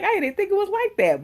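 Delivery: high, shocked disbelief; surprised. Retold or imitated. Viewer shocked at a TV scene, high and surprised. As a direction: gasp it out, voice way up. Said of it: "And I was like".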